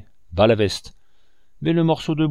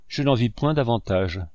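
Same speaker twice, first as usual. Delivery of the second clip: usual speaking voice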